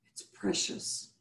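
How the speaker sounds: hushed and slow, savoring it; whispered reverence; soft slow whisper. Real, not acted. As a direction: breathe it out tenderly. Wellness host guiding listeners.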